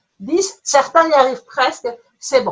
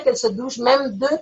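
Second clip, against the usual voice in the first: ordinary talk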